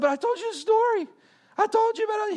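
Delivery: very high, loud mock protest; indignant squeal, imitating his teenage self; pleading protest. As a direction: plead your case in a hammed-up high voice. Retold protest to his boss.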